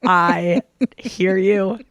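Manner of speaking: mock-obedient, very slow delivery; exaggerated slow reply. Patient answering the surgeon's warning, absurdly slowly. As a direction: stretch each word way out. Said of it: "And I was like".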